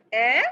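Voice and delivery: "এ" loud, open, like a surprised eh; ä demo. Shown as a demonstration. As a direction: punch out "এ" openly. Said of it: "ঠিক ওভাবেই হবে যে". (জোরে খোলা "এ")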